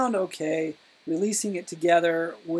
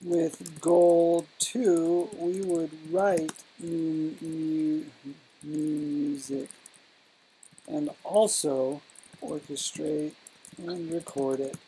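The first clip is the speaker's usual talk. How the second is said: drawn-out words with long gaps; halting, repeating words while the pen catches up; slow dictation; slower than usual. Real, not acted. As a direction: spell out each phrase slowly as you write. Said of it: "you're speaking too slow".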